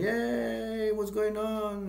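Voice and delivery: high drawn-out mock cheer; imitated cheering. Soldiers cheering outside, high mock cheer. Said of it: "a lot of soldiers cheering".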